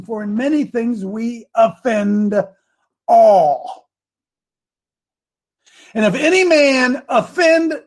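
declaimed reading, swelling on key words; preaching scripture. Acted. Preacher reading James to his listeners, declamatory. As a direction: proclaim the verse, drawing out the big words.

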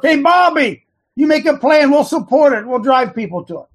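high, loud hail to a friend; calling out. Acted. Host calling on Bobby to start something. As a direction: shout his name, then push him to act.

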